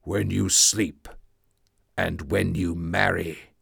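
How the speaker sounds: low, slow, menacing monster voice; a hint of a looming creature, drawn out low. The creature threatening Victor, low and slow.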